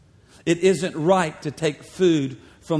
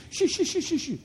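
mock shooing; 12 semitones higher, 4 dB quieter than usual. Crowd shooing the woman like a house pet, high mock shoo. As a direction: shoo shoo, jumping much higher. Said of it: "scoot the woman out".

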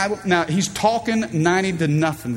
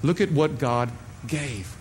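ordinary talk

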